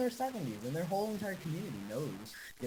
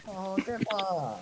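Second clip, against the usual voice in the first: long drawn-out, higher exclamation; mock groan at grandpa; 6 semitones higher, 5 dB louder, much slower than usual. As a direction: stretch it out in lightly embarrassed protest. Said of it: "and I'm like".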